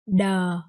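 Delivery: THE said as a long "duh"; hard D, gently falling on THE; crossed-D sound. Shown as a demonstration. Teacher confirms after learners' turn, THE as hard "duh". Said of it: "you will pronounce this one".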